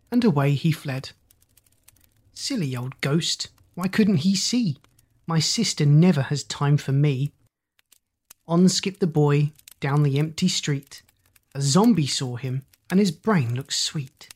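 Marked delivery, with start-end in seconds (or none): none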